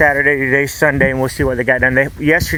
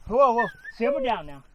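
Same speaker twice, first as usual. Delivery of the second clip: soothing; 6 semitones higher, 11 dB quieter than usual. Real, not acted. Soothing the caught cat.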